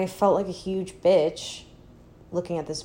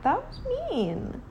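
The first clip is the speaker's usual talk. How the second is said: mock hurt; 5 dB quieter, slower than usual. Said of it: "there are times where I'm like".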